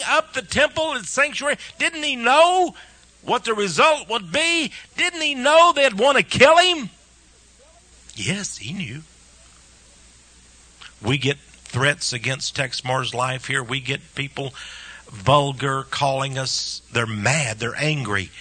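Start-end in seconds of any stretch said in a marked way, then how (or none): none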